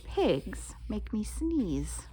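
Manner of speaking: ordinary delivery, nothing marked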